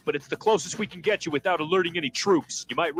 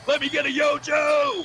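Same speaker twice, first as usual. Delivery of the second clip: higher, louder battle cry; rallying shout; 9 semitones higher, 3 dB louder than usual. Acted. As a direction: rouse the troops. Rallying cry before drop.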